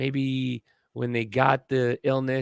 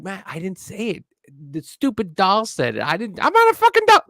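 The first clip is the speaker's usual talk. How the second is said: mock defensive yell; 9 semitones higher, 6 dB louder than usual. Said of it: "I'm like".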